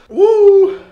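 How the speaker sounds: high, loud whoop of pain. Real, not acted. Vlogger after a big bite, shouting a pained woo. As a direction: let out a sharp whoop as the heat hits. Burning mouth, cries out.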